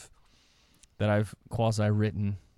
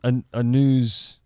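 news anchor voice; 7 dB louder than usual. Shown as a demonstration. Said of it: "it could be a newscast".

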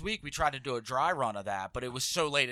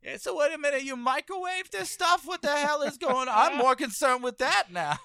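high mocking voice; 10 semitones higher than usual. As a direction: squeak it in mock outrage. Mock voice of manager.

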